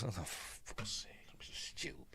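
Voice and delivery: a soft whispered curse at yourself; whispered self-reproach. Real, not acted. Viewer scolds himself under his breath, whispering. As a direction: whisper it, fed up with your own mistake.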